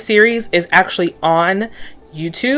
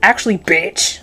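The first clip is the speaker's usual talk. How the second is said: sassy comeback; slower than usual. Pretend. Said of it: "I'd be like".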